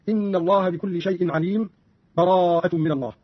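full stop and breath after عليم, then begin براءة; stop between verses. Shown as a demonstration. Teacher shows the first way to join two suras, stopping at عليم. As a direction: pause at عليم before starting براءة afresh. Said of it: "الوقف".

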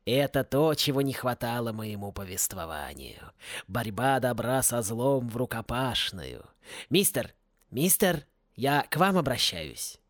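slow, low musing to himself; lightly voiced chronicler. A narrator's reading. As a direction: muse slowly over the scene, voice sinking. Chronicler savours the brawl.

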